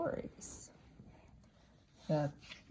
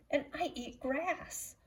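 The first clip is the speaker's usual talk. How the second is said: a lightly voiced kangaroo, higher and softer; 8 semitones higher, 3 dB quieter than usual. A narrator's reading. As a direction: answer gently as the kangaroo. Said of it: "said Kangaroo".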